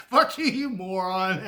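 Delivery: slow, laughing "Fuck you, you moron"; laughing insult. Retold or imitated. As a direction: spit it out while cracking up. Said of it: "our polite way of saying".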